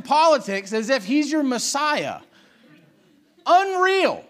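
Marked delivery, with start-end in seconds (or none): 3.5-4.2 loud, high disgusted cry; exasperated outburst. Real, not acted. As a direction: blast it out in disbelief.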